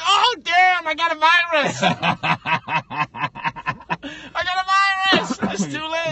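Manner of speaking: high, loud laughing mock; loud, high mock panic with laughter; mocking through laughs. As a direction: tease him, cracking up. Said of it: "And you caught".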